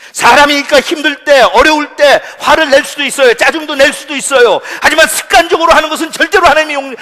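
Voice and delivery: loud, high, impassioned preacher; full-force preaching. Real, not acted. Preacher warning church members against habitual anger, full-force preaching. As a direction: thunder the warning at the congregation.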